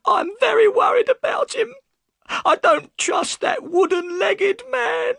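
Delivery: loud, very high tearful wail; worried wife crying out in a high voice; high-pitched wailing woman; bursting into tears. A narrator's reading. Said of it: "burst into tears".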